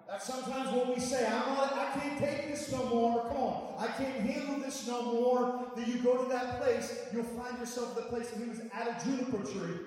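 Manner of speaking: loud, high preacher's cry, held; full-force preaching. Acted. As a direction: keep preaching it out at the top of your voice.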